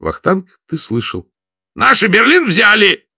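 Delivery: high, loud joyful shout. A narrator's reading. Vladimir shouting to Vakhtang that Berlin has fallen, loud and high. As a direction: shout the news out, overjoyed. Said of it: "повторил громче".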